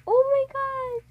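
high, loud oh my gosh; excited gasp. Real, not acted. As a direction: burst out with real delight. Reacting to rare pet.

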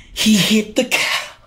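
high, drawn-out lament; distressed disbelief. Real, not acted. Streamer laments losing despite his trap, high slow distressed wail. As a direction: wail it slowly, breaking off mid-word. Says he'll cry over lost round.

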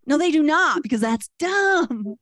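ordinary delivery

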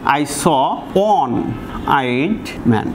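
each word drawn out slowly for the class; slow spoken example sentence; slow dictation. Shown as a demonstration. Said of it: "এই দুটি সেন্টেন্সের যে নাউনটা আছে".

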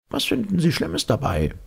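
high-pitched professor question; professor challenging. A narrator's reading. Professor defending his calendar to the captain, high challenge. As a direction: challenge him in a high character voice.

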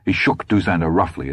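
ordinary delivery

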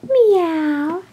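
high cartoon meow; cat meowing. Cat answers its owner's call, meowing.

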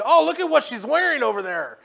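mock gossiping friend; distracted gossip. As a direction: blurt it out, attention elsewhere.

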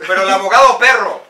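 loud, quick plug for the lawyer; hyped-up Spanish testimonial; insurance commercial testimonial. Pretend. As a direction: punch the lawyer's nickname in a mock ad. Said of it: "we need to do your insurance commercial".

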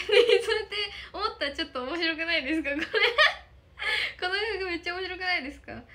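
loud, high laughing question; laughing hard at her own joke. Real, not acted. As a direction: crack up while asking. Giggling about her camera angle.

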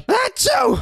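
very high, loud achoo; mock sneeze. Robot sets off its ability, loud fake sneeze. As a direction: explode into a squealed fake sneeze. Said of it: "Dude, stop sneezing".

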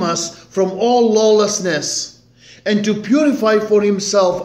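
preaching scripture. Acted. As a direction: proclaim the verses to the congregation. Reads scripture at full force.

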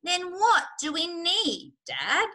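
high, slow puzzled question; young fox asking. A narrator's reading. Smallest fox asking Dad what they need, puzzled. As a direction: draw out a child's question.

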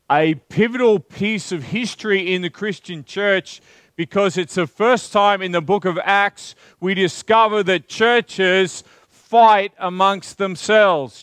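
usual speaking voice

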